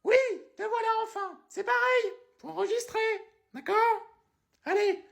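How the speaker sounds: a shrill-free high caricature lady, calling then chatting; high put-on woman's voice. Woman calls Hippolyte then says goodbye, high put-on voice.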